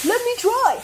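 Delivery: high, eager whoop. Acted. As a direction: burst out with excitement. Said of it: "whooped Douglas".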